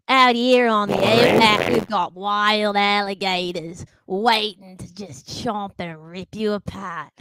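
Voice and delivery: slow Australian outback guide; mock-Australian outback drawl; Australian accent. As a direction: draw it out like an Australian wildlife warning. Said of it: "do an impression of an Australian accent".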